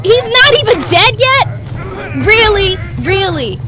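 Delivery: loud, high, disbelieving outcry; can't believe the boss is still standing; outraged disbelief. Real, not acted. Gamer yelling at a boss that won't die, loud disbelief. Boss survives big attack.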